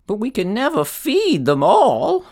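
put-on high, thin old woman voice. A narrator's reading. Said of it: "said the very old woman".

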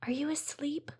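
soft, hushed question in the dark; whispered. A narrator's reading. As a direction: hush it so mom won't hear. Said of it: "Zara whispered".

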